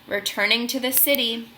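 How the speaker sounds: ordinary delivery, nothing marked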